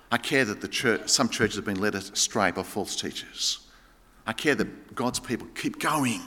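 low, soft and earnest; hushed, tender pastoral sincerity; quiet heartfelt concern. Acted. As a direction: drop right down, speak from the heart. Turns to Paul's heartfelt concern.

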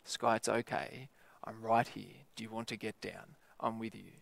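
put-on calm a frightened child up close; gentle reassurance. Speaker beside girl, gentle.

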